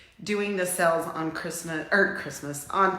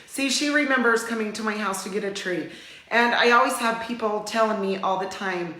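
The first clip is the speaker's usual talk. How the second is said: ordinary talk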